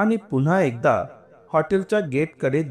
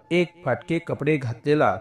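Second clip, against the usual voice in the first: plain reading voice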